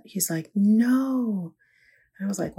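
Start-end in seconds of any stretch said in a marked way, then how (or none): 0.5-1.5 put-on upset child. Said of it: "Jackson was so upset".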